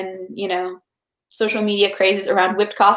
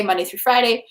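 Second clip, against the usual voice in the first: ordinary delivery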